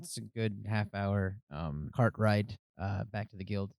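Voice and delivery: normal talking voice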